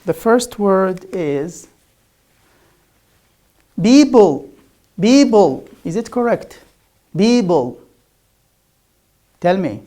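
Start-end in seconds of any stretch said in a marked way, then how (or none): 3.8-5.7 "Bible" for people, with a voiced B; loud, clear "Bible" with B where P belongs. Shown as a demonstration. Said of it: "I pronounced P as B". 7.1-7.8 "Bible" for people, voiced B in place of P. Shown as a demonstration. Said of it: "as B".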